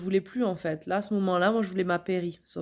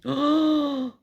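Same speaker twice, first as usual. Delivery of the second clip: high, sudden oh of alarm; startled cry; shocked gasp; 8 semitones higher, 4 dB louder than usual. Retold or imitated. Feels the baby's head.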